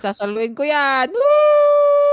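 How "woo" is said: high, loud yelp; wild excited yell; excited whoop. Real, not acted. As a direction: whoop as it blows up. Catching the grenade, whooping.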